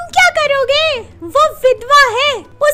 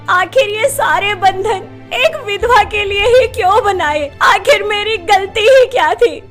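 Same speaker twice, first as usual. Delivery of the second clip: pained questioning; 8 semitones higher, 6 dB louder than usual. Acted. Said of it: "सारा दर्द अपने सवालों से".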